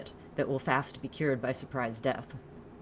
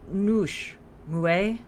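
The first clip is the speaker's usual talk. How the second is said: higher, louder, uncertain try at a name; faltering query; 6 semitones higher, 6 dB louder than usual. A narrator's reading. Newcomer groping for a stranger's name, faltering. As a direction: try each name separately, unsure. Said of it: "she faltered".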